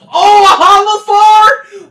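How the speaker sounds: high, loud cry of disbelief; loud excited gasp at the loot; excited yell. Real, not acted. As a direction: shout it out like you hit the jackpot. Finds rare loot, yelling.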